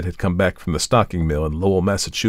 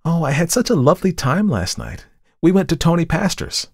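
put-on high, girlish gush of gossip; chattering; 11 semitones higher than usual. A narrator's reading. Model tells the artist about last night, chattering. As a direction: chatter away in a raised, lilting voice. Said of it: "she began to chatter".

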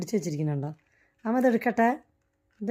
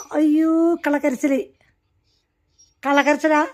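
a high, loud teasing cry of disbelief; mock outcry; 6 semitones higher, 5 dB louder than usual. Real, not acted. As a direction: call out the fake crying, teasing. Mocking the pet's fake crying. (കളിയാക്കി ഉറക്കെ)

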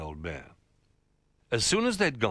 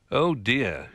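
lightly voiced old bear, louder and higher; dismayed Old Bear; 4 semitones higher, 6 dB louder than usual. Old Bear seeing the crumbs, loud mild dismay. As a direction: let out a rounded, dismayed exclamation.

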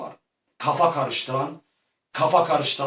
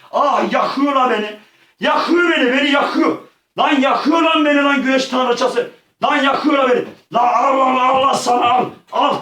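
pained shouting; 9 semitones higher, 5 dB louder than usual. Real, not acted. Burned by sun goddess.

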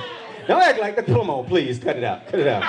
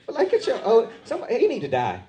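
ordinary talk, nothing marked

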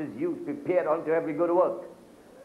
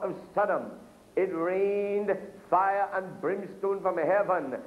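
higher preaching read, slowing at the end; scripture reading; 5 semitones higher, slower than usual. Acted. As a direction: build the verse to its grave close. Reads Luke in preaching voice.

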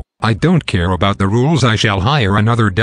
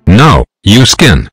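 loud refusal; 9 dB louder, slower than usual. Acted. Refusing the nomination loudly.

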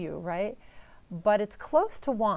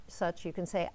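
ordinary delivery

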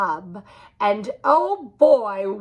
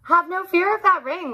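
laughing; 9 semitones higher, 3 dB louder, faster than usual. A narrator's reading. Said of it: "laughed the cat in the hat".

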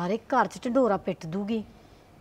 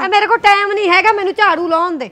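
much higher and louder, brushing her off; impatient shout; 11 semitones higher, 12 dB louder than usual. Acted. Sweeping woman snapping at gossiping neighbour, loud and impatient. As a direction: shout her away so you can sweep.

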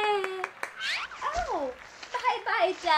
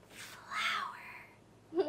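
hushed, whispered word; 3 semitones lower, 14 dB quieter than usual. Pretend. Host teaches children the word flower, whispering it. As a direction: whisper it slowly, as if in awe.

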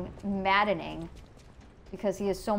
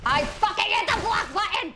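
enraged shout, far higher and louder; furious yell; 12 semitones higher, 7 dB louder than usual. Real, not acted. Streamer protesting the block button failed, furious yell. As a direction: scream at the game that you did press it. Streamer raging at game controls.

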